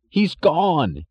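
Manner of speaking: high, frantic cry of a frightened man; panicked cry. A narrator's reading. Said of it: "he cried".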